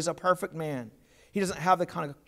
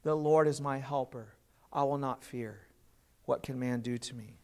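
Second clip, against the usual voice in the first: softer and lower, an inward affirmation fading down; quiet confession; 6 semitones lower, 5 dB quieter than usual. Shown as a demonstration. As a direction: say it softly to yourself, trusting. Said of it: "for us to say".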